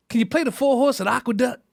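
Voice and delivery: a hint of an old lady, dead serious; grandmother's voice. Grandmother asking for a bet.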